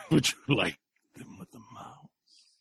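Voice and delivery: breathy whisper with a laugh; whispered temptation. Acted. Dracula whispering a temptation to Sam. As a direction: whisper it like a secret.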